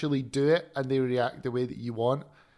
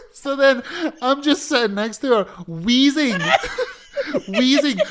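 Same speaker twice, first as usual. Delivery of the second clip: high, loud words broken by laughter; laughing; 10 semitones higher, 6 dB louder than usual. Real, not acted. A storyteller laughing through his own story, high and loud. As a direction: tell it while cracking up.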